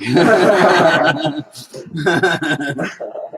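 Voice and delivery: loud laughter through the words; laughing. Real, not acted. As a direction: crack up mid-sentence. Child's cheeky comeback.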